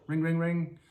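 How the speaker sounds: high, loud ring-ring; imitated phone ring. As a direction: mimic a ringing telephone.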